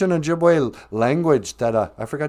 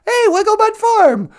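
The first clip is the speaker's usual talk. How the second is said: much louder and much higher shout of the greeting; excited greeting; 9 dB louder than usual. Real, not acted. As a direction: call out Hey, Wiggle Butt Farm! with delight. Loud greeting of a viewer.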